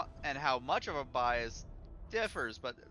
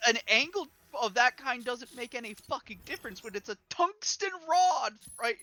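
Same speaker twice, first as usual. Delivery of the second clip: higher, emphatic argumentative rant; exasperated retort; 5 semitones higher than usual. Retold or imitated. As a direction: argue back in heated disbelief. Retold heated argument about tank armor.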